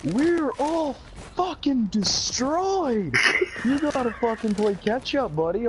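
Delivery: put-on high, stretched-out drunken holler; drunk buddy. Drunk buddy urging the late arrival to catch up, high drawn-out yell. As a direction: crow it like a plastered pal. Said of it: "My buddy fucking stumbles on over to me".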